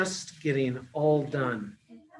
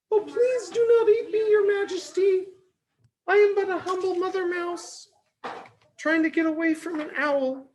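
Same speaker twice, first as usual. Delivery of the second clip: very high, tiny squeaking mouse; squeaky mouse voice. Acted. Pleading with the lion king, squeaky mouse voice. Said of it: "Mother Mouse squeaked".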